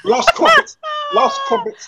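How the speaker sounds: very high mock-English squeal; very high laughing mock British accent. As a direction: squeak it like a silly Brit. Mocking British voices.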